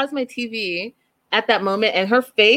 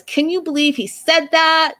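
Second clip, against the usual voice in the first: mock indignant; 8 semitones higher than usual. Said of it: "she gets mad and says".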